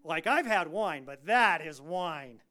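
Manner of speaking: loud, impressed connoisseur with a drawn-out THAT; amazed head waiter. Acted. Head waiter tasting the wine at the wedding, loudly amazed. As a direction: savor the verdict, leaning hard and slow on THAT. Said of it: "head waiter dude".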